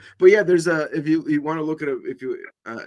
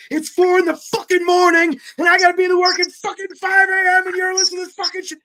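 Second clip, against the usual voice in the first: furious high loud yelling; angry roommate shout; 14 semitones higher, 7 dB louder than usual. Retold or imitated. Roommate woken at four yelling at drunks, furious shout. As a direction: roar at the drunk housemates. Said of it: "He's like".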